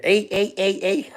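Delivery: high-pitched Scouse catchphrase, breaking into laughter; mock Scouse accent. Streamer joking with a viewer about accents, mock Scouse accent. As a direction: send up a Scouser with high little cries. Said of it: "I'm a Scouser. People hate my accent".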